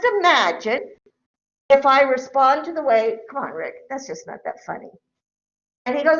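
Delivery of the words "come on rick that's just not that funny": low, soft, disapproving murmur; understated, dropped-voice reproof; mild reproach. Acted. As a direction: quietly talk him down. Acts mild reproach to husband.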